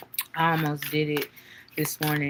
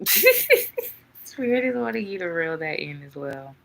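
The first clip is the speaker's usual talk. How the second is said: tertawa. Real, not acted. Repeats the line laughing.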